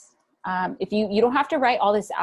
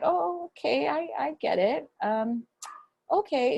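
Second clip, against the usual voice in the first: lightly voiced therapist, pitched well up; imitating sweet therapist; 8 semitones higher than usual. Therapist answers a client who can't do grounding, imitated hesitant acceptance. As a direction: play the hesitant therapist in a higher voice.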